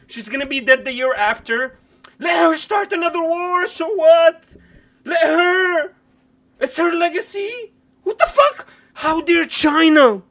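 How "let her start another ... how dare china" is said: high, loud, laughing mock indignation; hammed-up mock outrage. Streamer parodying Pelosi fans, high mock outrage. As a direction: play the outraged defender, over the top. Mocking imagined Pelosi defenders.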